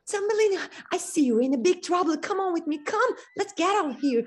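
high, loud, urgent call; urgent swallow. Acted. Swallow comes to rescue Thumbelina, high and urgent. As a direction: hurry her away from danger.

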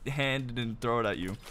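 taking time over it. Real, not acted. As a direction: stretch the threat out word by word. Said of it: "took time to say that shit".

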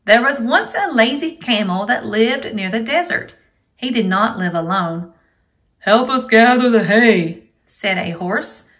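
loud, lively storybook reading; reading to a toddler. Acted. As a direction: read aloud to small children. Teacher reads story to kids.

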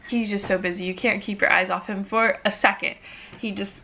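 normal talking voice

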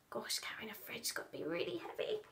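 fast hushed aside; reader's whispered comment. Real, not acted. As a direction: whisper it quickly. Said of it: "aside to listener".

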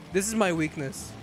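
ordinary talk

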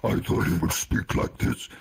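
very low, rasping alien growl; Judoon monster voice. Shown as a demonstration. Actor showing the director the alien's voice, low monster growl. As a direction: growl it from deep in the throat. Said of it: "So how's it gonna speak?".

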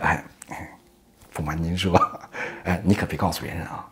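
low, soft sheepish admission; shamefaced, hushed and halting; embarrassed confession. A narrator's reading. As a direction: confide it hesitantly, voice dropped. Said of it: "猴子脸唰一下通红".

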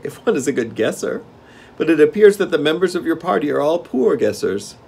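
high-pitched king taunting his guests; smug Gnome King. A narrator's reading. King belittles Dorothy's party, smug and high. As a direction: needle them in a high, loud voice.

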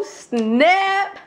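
usual speaking voice

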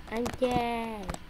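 ordinary delivery

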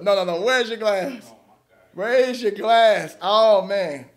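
ordinary delivery

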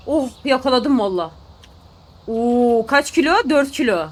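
ordinary talk

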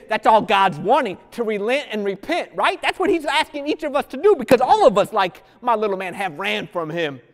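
rising sermon fervor; full-force preaching. Acted. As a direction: preach it out to the whole room.

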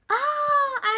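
high sharp surprised aa; surprised gasp. Real, not acted. Spotting a follower arrive, gasping in surprise. Sudden discovery.

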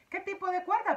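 high, loud curious question; curious grasshopper. A narrator's reading. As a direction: ask with curiosity. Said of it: "preguntó curioso el viejo saltamontes".

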